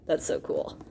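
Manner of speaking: hushed, breathy delight; whispered awe. Acted. Reader marvels to listening kids in a whisper. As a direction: whisper it like a secret wonder. Awed aside about the book.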